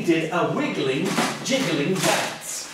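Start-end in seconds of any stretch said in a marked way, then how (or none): none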